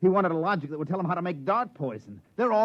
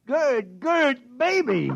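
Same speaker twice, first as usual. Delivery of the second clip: higher, louder joyful calling of her name; excited cry; 7 semitones higher, 5 dB louder, much slower than usual. Acted. Frank grabbing his wife in sudden excitement, joyful shout. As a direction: burst out with sudden delight.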